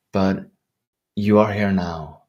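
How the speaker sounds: ordinary talk, nothing marked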